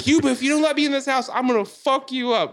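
fast, high-pitched threat; imitated screaming. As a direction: cut in yelling as the enraged woman. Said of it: "screaming at the guy inside".

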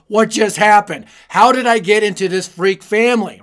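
half-shouting in disbelief at the uncles; shocked outburst. Retold or imitated. Boy shocked at fighting uncles.

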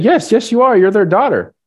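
almost cry it out to convince her; insistent. Retold or imitated. Girl insisting Stephanie is the daughter.

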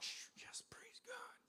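whispered praise, barely audible; very soft, unvoiced-sounding whisper under the breath; hushed relief. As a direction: whisper a secret thank-you to God. Secret relief at no answer.

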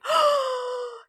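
high, sharp thrilled gasp; excited gasp. Retold or imitated. Historian realizing an obscure barbarian is featured, thrilled gasp. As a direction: act out a big gasp of delight. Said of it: "I'm like".

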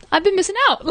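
high, delighted "I've been missing out"; sudden eureka in the voice; light bulb moment, thrilled; excited realization. Retold or imitated.

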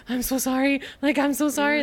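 a touch of panicked apology; mock panic. Thorn to Cress after stabbing her, mild mock panic. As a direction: lightly imitate frantic apologizing. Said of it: "he's, like, panicking".

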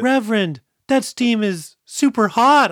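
high, loud, slow put-on video game helper; mock helper voice. Robin warning the Reverend about the steam, in a high, loud mock voice. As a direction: explain the obvious in a high, loud, dragged-out voice.

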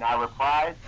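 usual speaking voice

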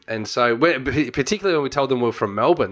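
ordinary talk

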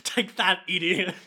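mock triumphant laugh and taunt; hammed-up gloating jeer. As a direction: crow it high with a laugh. [name] gloats over captive Hector.

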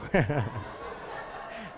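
quiet rising chuckle; amused chuckle after the punchline; soft laugh. Real, not acted. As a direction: laugh gently at the joke. Laughing at own joke.

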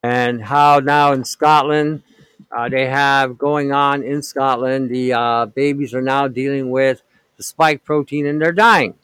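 ordinary talk

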